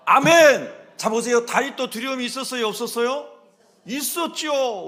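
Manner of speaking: loud, high-pitched preaching shout; full-force preaching. Real, not acted. (목소리를 높여 힘차게 외치는 설교)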